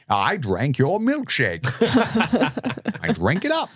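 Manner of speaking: slow, high gloating quote; menacing oil man. Pretend. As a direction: savor each word like a villain. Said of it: "he says something like".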